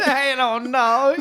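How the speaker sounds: put-on high, slow, dazed child voice with a laugh; mock little kid shrugging it off. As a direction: drawl it like a clueless toddler.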